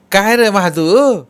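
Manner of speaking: high, loud call with drawn-out words. Acted. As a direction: hail Mahadu, stretching the question. Woman calls out to Mahadu. (मोठ्याने, चढ्या आवाजात हाक)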